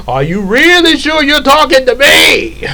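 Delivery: villain can't believe he's addressed; mock disbelief. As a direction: play dumb in a squeaky voice. Comic disbelief.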